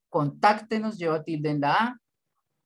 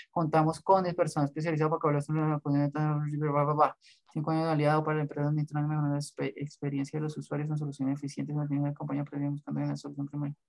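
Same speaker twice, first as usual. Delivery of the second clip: skim reading; 3 dB quieter, faster than usual. Real, not acted. Teacher skimming a student's company text for mistakes, rapid skim reading. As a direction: rattle through the text, trailing softer.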